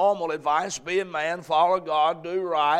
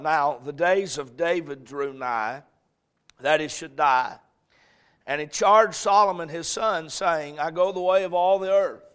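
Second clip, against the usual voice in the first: solemn Bible reading; scripture reading; 4 dB louder than usual. Acted. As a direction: read the verses with weight. Reads scripture aloud.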